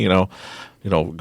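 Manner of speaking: ordinary talk, nothing marked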